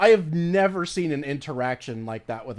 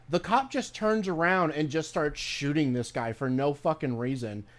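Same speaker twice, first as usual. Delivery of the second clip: usual speaking voice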